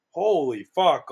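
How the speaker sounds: ordinary talk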